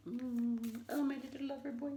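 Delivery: high, sweet cooing. Real, not acted. Cooing baby talk at her cat.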